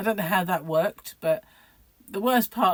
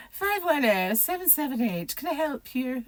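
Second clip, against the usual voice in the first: high, put-on telephone manner; imitated formal phone answering voice; mum's phone voice; 7 semitones higher than usual. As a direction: recite it like an office receptionist. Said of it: "what is the phone voice all about?".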